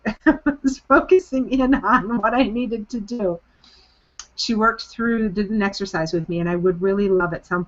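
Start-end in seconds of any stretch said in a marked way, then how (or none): 0.1-3.4 laughing. Real, not acted. Laughing denial of dozing.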